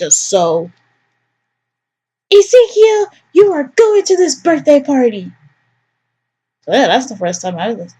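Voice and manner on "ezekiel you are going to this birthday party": mother laying down the law; higher commanding mom voice; stern mother. Acted. Mother scolds son by name.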